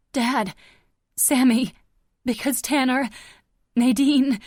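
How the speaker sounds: loud, high blurted names; frantic shouting. A narrator's reading. Hurt daughter trying to warn her father, panicked shouts. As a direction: shout each name in a panic.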